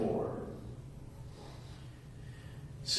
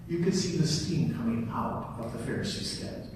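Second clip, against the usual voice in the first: normal talking voice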